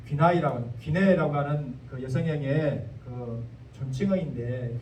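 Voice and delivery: normal talking voice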